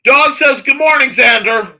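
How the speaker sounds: loud, high-pitched shouted greeting; calling out. Real, not acted. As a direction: holler the greeting, dragging out the name.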